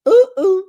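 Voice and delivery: high, loud double hoot; sharp high-pitched "ooh, ooh" reaction; scandalized "ooh". Real, not acted. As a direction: hoot it like juicy gossip just landed.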